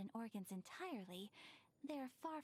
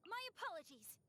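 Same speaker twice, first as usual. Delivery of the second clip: much higher, sharp cry; battle callout; 8 semitones higher than usual. Acted. Swordswoman apologizes to her foe while striking, high cry. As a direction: snap it out mid-strike.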